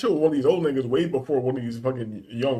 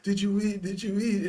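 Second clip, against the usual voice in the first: high, fretting mother repeating herself; worried mom; 8 semitones higher than usual. Retold or imitated. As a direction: fuss over him like a doting mom. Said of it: "He'd be like".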